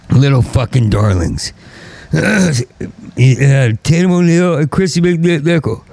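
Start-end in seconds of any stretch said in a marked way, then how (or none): none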